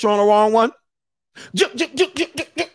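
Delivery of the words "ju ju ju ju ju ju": stabbing sound. Retold or imitated.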